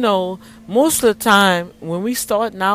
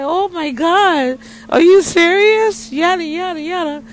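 much higher, louder excited shout; shouting with joy; 11 semitones higher, 8 dB louder than usual. Retold or imitated. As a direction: scream it in disbelief and delight. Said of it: "we just were shouting".

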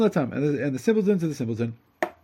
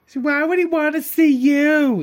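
much higher, loud scoffing sitcom wife; Peggy Bundy; 13 semitones higher, 7 dB louder than usual. Retold or imitated. Wife mocks her husband's news, high sitcom scoff. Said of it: "it's like Peggy Bundy".

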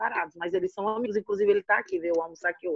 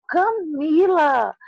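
startled calling out; 7 semitones higher, 4 dB louder, much slower than usual. Retold or imitated. Mother calls out daughter's name.

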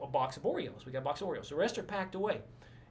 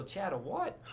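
higher-pitched puzzled question; mock bafflement; 5 semitones higher, slower than usual. Missionary questioning supervisor about Chattanooga, baffled. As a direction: act out baffled disbelief at an odd name.

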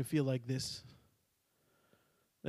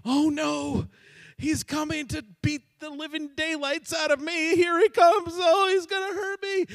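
panicked son; 12 semitones higher, 8 dB louder than usual. Acted. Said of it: "he's probably thinking".

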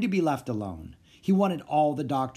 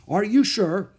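shocked; 8 semitones higher, 7 dB louder, slower than usual. Acted. Shocked phone call.

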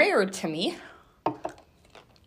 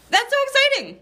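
excited; 4 dB louder than usual. Real, not acted. Celebrating a thousand plays.

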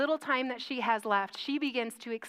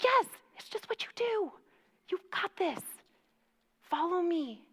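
lightly voiced friend, higher, reassuring her; a hint of the friend coaxing her along, pitched up; 4 semitones higher, 4 dB quieter than usual. Retelling friend Stephanie reassuring her before communion, lightly voiced and higher. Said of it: "She's like".

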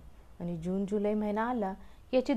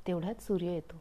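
plain reading voice

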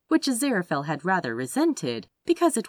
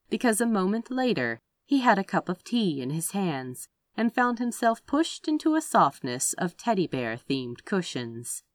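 plain reading voice

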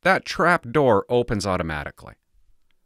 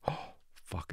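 whispered curse; 5 semitones lower, 20 dB quieter than usual. Real, not acted. Alone, prying open the taser.

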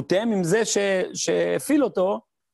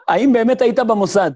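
the question again, much louder and punched; louder repeat; 2 semitones higher, 7 dB louder than usual. Retold or imitated. Teacher repeats the cheeky question for laughs, loud. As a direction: belt out the question with amused emphasis.

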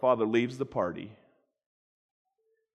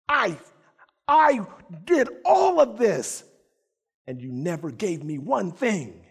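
bitter complaint; 3 semitones higher than usual. Acted. Elder son rebukes his father for never giving him anything. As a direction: vent years of resentment at your father.

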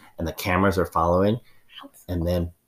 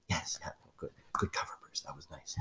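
hushed whisper; 16 dB quieter than usual. Acted. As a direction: whisper it close. Ma'am whispers praise.

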